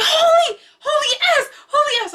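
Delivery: high, loud shocked yelling; mom shrieking. Retold or imitated. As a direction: play the panicked mom in full cry. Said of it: "She's like".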